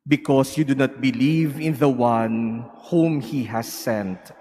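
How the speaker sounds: ordinary talk, nothing marked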